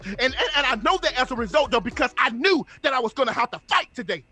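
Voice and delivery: full-force, rousing preacher delivery; motivational preaching. Real, not acted. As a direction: fire up the listeners like a preacher.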